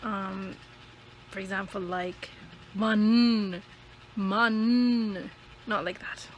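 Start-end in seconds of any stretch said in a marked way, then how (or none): 2.7-5.3 "man" with a long, loud N; stressed consonant. Shown as a demonstration. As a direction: hold the final N of "man", exaggerated. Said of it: "and never the consonants".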